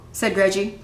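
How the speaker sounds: usual speaking voice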